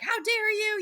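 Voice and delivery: high-pitched mock indignant cry; imitating angry fiancée. Fiancée yelling at the other woman, high mock fury. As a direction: fling it at her, pitch jumping way up. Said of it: "and she's like".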